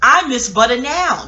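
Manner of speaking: emotional. Real, not acted. Confessing a craving while cutting back on dairy, emotional. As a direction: wail it slowly with feeling. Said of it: "I'm about to get emotional".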